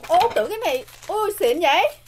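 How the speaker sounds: cao giọng reo lên vì bất ngờ; thốt lên sung sướng; ngạc nhiên thích thú. Real, not acted. Bất ngờ khi thấy khay. (ngạc nhiên reo lên)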